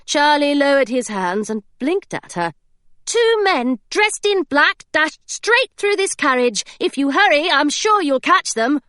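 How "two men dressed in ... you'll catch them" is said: put-on high, bright girl's voice, earnestly fibbing; a little girl covering for friends, a bit too eager; Hilary, eager young girl. Hilary misleads the inspector.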